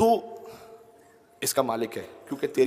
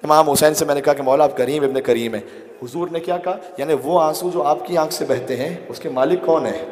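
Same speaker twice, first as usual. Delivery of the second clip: impassioned preaching; 3 semitones higher, 8 dB louder than usual. Real, not acted. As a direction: cry it out to the crowd. Emotional shouted preaching. (جذباتی بلند خطابت)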